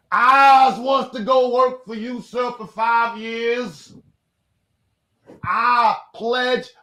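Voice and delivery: high, drawn-out mock pledge to a master; slow, hammed-up high voice of a would-be servant; pleading indentured Irishman. Offering himself as servant to sir, high hammed-up plea. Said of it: "I don't know how to do your accent".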